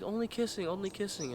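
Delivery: lightly voiced woman setting limits; high, insistent mimicry; mock protest. Acts out her setting limits.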